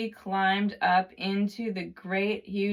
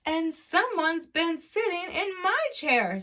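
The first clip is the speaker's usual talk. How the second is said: much higher mother bear voice; Mama Bear's voice; 10 semitones higher than usual. A narrator's reading. Narrator voices Mama Bear about her chair, pitched up. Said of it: "said Mama Bear in her medium-sized voice".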